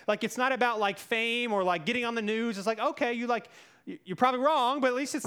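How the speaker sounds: ordinary talk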